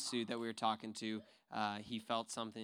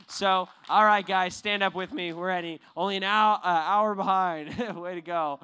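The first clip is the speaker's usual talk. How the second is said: much louder and higher, rallying the group; calling out to the room; 7 semitones higher, 17 dB louder than usual. Real, not acted. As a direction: get everyone on their feet. Leader calls the group to stand.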